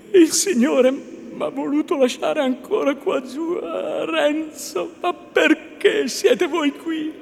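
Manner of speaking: high, plaintive female voice; distressed, high-pitched lament; anguished young woman. A narrator's reading. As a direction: plead slowly, drawing out the name. Lucia answering Renzo in anguish.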